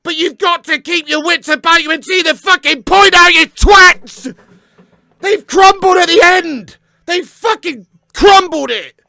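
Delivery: furious fan shouting abuse at his own team; angry yell. Real, not acted. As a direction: tear into them, shouting. Fan berating his team's players.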